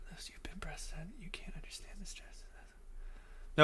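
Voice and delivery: breathless, unvoiced whisper, slowing on the end; hushed whispered reading. Shown as a demonstration. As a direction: whisper the comment like a secret. Reading a viewer's comment.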